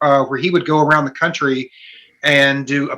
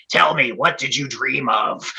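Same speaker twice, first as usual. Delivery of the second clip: put-on old gruff voice; 2 semitones lower, slower than usual. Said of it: "he's got that old gruff voice".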